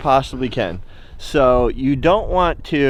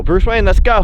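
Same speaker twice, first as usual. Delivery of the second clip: calling out to dog; 7 semitones higher, 6 dB louder than usual. Real, not acted.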